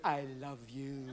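softly purred cat voice; sweet-talking cat. Acted. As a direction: coo it like a cat rubbing your leg. Cat cuddling up in cold.

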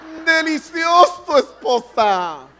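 high, loud and slow, straining to sound delighted; overacted "delicious" through gritted teeth; forced fake praise. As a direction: choke out a compliment you don't mean. Husband forcing praise of bad food.

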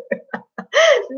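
sudden high squeal of a laugh that falls away; high burst of laughter. Real, not acted. Amused by the fake baby's batteries, a high laughing burst. Laughing at imagined royal mishap.

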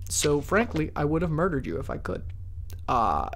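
usual speaking voice

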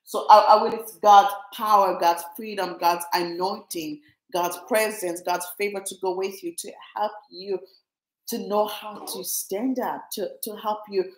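low, earnest prayer voice. Acted. Resumes prayer of blessing.

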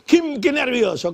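high, loud, slow scoffing; mocking disbelief. Comic scoffing at wife's nerves excuse, high and loud.

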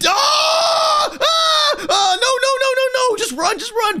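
high terrified screams; screaming. Real, not acted. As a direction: scream in terror. Plane diving at him.

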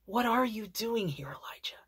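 soft, gentle near-whisper, fading out on the name; gentle voice of God. Acted. God gently asking his discouraged child Elijah, hushed near-whisper. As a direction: ask it tenderly, like a father to his child. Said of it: "he responds in gentleness".

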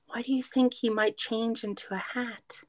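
plain reading voice

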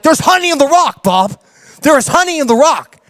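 eager excitement over the honey; excited. Retold or imitated. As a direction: blurt it out, unable to contain it. Excitedly telling Bob the revelation.

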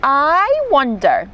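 slow, musing lilt; wondering. A narrator's reading. Izzy eyes the tiger, wondering. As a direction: stretch it out, toying with a naughty idea. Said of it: "thought Izzy".

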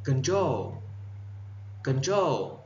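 "Control" as con-TROL, stressed on the end; second-syllable stress. Shown as a demonstration. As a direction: lean on TROL in "Control", twice. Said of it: "Nhấn âm, âm hai". (nhấn âm hai của "Control")

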